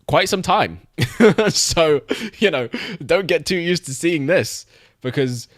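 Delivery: high-pitched, laughing through the words; amused, laughing. Real, not acted. Streamer joking about the sequel's long development, amused and laughing. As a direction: break into a real chuckle mid-sentence.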